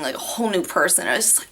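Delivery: normal talking voice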